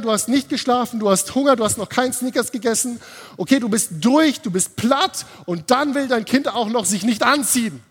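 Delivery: playing the worn-out parent listing every excuse. Parent talking down to stressed self. (gespielt genervt, deutlich höher)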